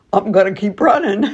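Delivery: put-on a cheeky kid brushing it off; playful kid voice. Isa ignoring the teacher, fast and giggly. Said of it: "Isa just hears".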